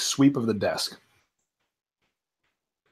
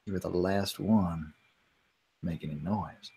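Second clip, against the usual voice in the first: intense low murmur; 7 semitones lower, 12 dB quieter than usual. Acted. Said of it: "looks at Monsieur Noir very intensely".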